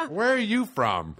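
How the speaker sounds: high, slow mock crowd work; hammed-up stand-up holler; shouting out things. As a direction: call it out like a clueless comic. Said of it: "Just shouting out things".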